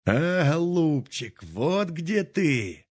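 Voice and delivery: high, slow and drawn-out cry of triumph; old man exulting over the buried pot; gleeful exclamation. Acted. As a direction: crow over the find, stretching each word. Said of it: "вскрикнул дед".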